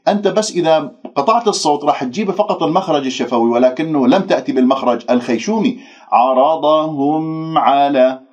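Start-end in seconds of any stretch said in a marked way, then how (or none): 6.1-8.2 عرضهم على with the m fully sounded; clear nasal m. Shown as a demonstration. Teacher asks the students to notice the m. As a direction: stretch عرضهم على slowly, letting the m hum. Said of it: "لاحظتم الآن كيف سمعتوها".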